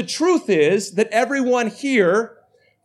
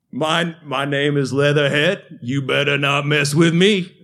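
put-on low Cajun accent, a laughing boy's bravado; 7 semitones lower than usual. To the playground bully, a lower Cajun-accented threat. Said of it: "in my best Cajun accent".

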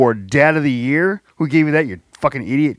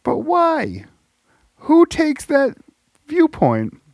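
high, drawn-out disbelief; can't believe anyone thinks that; incredulous; much slower than usual. Real, not acted. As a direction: ask in high, stunned disbelief.

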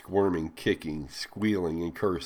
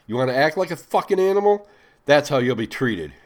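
higher, louder contemptuous outburst; a raised, higher, furious rebuke; angry; 8 semitones higher, 8 dB louder than usual. A narrator's reading. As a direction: lash out at her with disgust. Master glares at rebelling slave.

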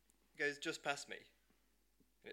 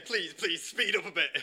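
higher, louder begging, mildly exasperated; retold frantic pleading; 4 semitones higher, 5 dB louder than usual. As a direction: plead urgently, as if retelling your panic. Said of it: "I'm going crazy saying".

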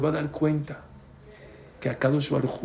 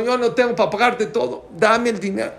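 raised, higher-pitched mock protest; flustered retort; 6 semitones higher, 4 dB louder than usual. Pharmacy man answering the frantic mother, flustered. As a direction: act out an overwhelmed reply, pitched up.

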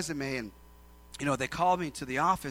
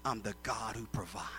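voice of God; 7 semitones lower, 12 dB quieter than usual. Acted. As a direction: let it sink almost to a whisper. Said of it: "God says".

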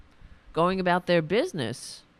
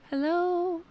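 pet voice; 12 semitones higher than usual. Pretend. Greeting on the dog's behalf.

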